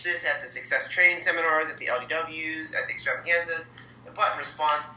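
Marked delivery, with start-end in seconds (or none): none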